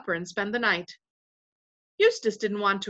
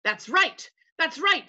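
mildly cheerful chorus, pitched up; cheerful agreement; 8 semitones higher, 3 dB louder than usual. A narrator's reading. Voices agree with Caspian, mildly cheerful. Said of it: "said the voices cheerfully".

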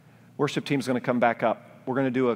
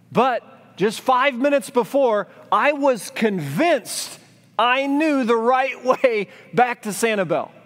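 self-mocking certainty; 12 semitones higher, 6 dB louder, slower than usual. Mocking his own certainty.

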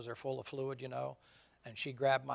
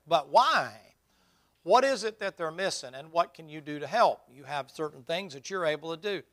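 loud, emphatic sermon delivery with raised pitch; forceful preaching; 2 semitones higher, 10 dB louder than usual. Real, not acted. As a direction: drive the point home to the congregation.

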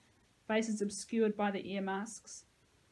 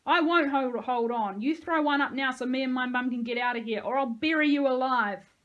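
loud, raised, threatening tirade; angry shouting; 4 semitones higher, 8 dB louder than usual. A narrator's reading. Boy threatens those in the pit, angry shouting. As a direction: yell the threat in a fury.

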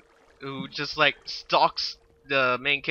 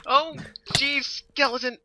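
high-pitched cry of surprise; startled; 12 semitones higher than usual. Real, not acted. Player suddenly spotting another skeleton, startled. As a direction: blurt it out as the enemy appears.

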